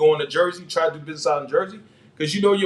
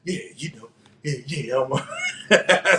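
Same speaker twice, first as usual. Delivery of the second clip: happy laughing reply; slower than usual. Retold or imitated. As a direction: chuckle it out like he feels he's won. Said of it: "He's like".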